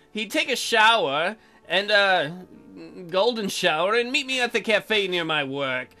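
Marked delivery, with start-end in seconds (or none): none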